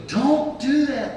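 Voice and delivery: high, loud, drawn-out warning; emphatic, stretched-out reprimand; retold scolding. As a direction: imitate an alarmed attorney cutting in. Attorney scolds him, retold.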